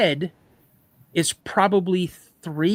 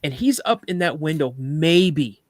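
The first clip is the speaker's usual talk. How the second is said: plain reading voice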